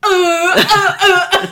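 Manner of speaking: loud, high honking attempt at an air horn; air horn noise. Shown as a demonstration. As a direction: honk like a failed air horn, like an angry seal. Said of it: "Can I do an air horn sound".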